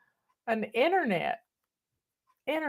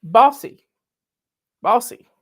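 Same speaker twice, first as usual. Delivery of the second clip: loud, emphatic bossy declaration; proudly bossy, punched out loud; 2 semitones higher, 8 dB louder than usual. Shown as a demonstration. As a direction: bark it out like the boss of the house. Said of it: "if nothing else".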